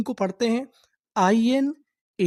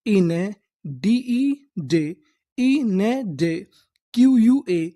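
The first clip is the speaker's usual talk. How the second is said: very slow piece-by-piece reading; slow spelling out, one chunk at a time; slow syllable build-up; much slower than usual. Shown as a demonstration. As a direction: build the word from its parts. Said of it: "तो इतनी meaning क्या बनी?".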